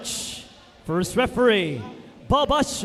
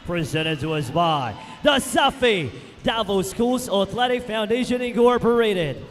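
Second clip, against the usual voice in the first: usual speaking voice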